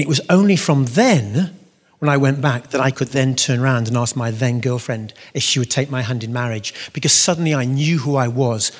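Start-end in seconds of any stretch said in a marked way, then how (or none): none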